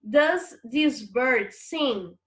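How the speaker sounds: slow, deliberate English sentence; slow classroom reading of the English question; slow model reading. Shown as a demonstration. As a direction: read it slowly so students follow. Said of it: "teacher reads exercise sentence aloud".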